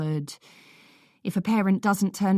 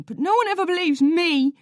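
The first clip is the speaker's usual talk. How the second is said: much higher, loud crying protest; tearful shout; 12 semitones higher, 5 dB louder than usual. A narrator's reading. Boy crying that nobody believes him, tearful shout. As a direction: shout through tears. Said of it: "Lloyd shouts, tears falling".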